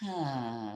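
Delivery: low, soft "ah" sigh; slowed-down release. Shown as a demonstration. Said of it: "Slow it down a little bit".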